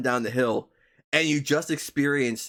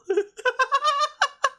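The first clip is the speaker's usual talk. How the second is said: high-pitched uncontrollable laughing fit; real, breathless cackling laughter; helpless laughter. Real, not acted. Streamer cracks up at marriage blue, helpless laughter. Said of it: "I can't stop".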